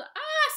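very high loud yelp; panicked cry. Acted. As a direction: squeal in alarm. Mister Bingle panics.

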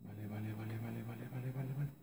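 barely audible low vale vale; muttered under breath. Real, not acted. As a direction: mumble it almost silently to yourself. Muttering to himself. (murmullo casi inaudible)

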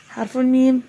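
normal talking voice

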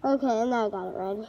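muffled inside rubber mask; breathing through mask hose; speaking through a gas mask. Real, not acted. Seen 2: Full rubber gas mask with hose worn throughout labelled words.

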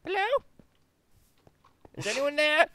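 high tentative call; calling into the dark. Real, not acted. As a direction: call out to anyone nearby. Calls out exploring alone.

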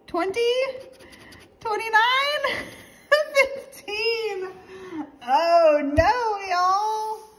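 high, loud, drawn-out dismay; laughing-in-despair loud high cry; exasperated disbelief. Real, not acted. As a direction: wail the losing numbers up high. Bad streak of losing numbers.

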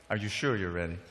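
low, soft teasing follow-up; hushed check. Real, not acted. Preacher double-checks the audience before the shock, hushed and low. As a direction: drop right down to build suspense.

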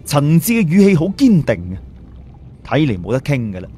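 usual speaking voice

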